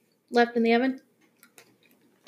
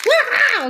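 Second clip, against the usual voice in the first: surprised yelp; 12 semitones higher, 4 dB louder than usual. Real, not acted. Teeth tearing open snack pack.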